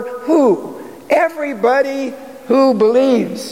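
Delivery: "who" asked high, then "Everybody" answered high; teasing out the answer to the congregation; pointed rhetorical question. Shown as a demonstration. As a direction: ask "who?" and answer "Everybody who believes" on a lifted pitch. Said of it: "he says".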